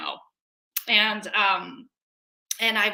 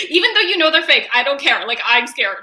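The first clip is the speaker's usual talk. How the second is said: laughing; 4 semitones higher, 9 dB louder, faster than usual. Real, not acted. Admitting to listeners a fear of fake haunted houses, laughing.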